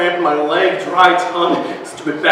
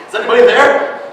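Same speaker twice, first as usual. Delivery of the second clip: a higher, louder call into the dark; frightened call out; 6 semitones higher, 4 dB louder, faster than usual. Acted. Boy calling into darkness.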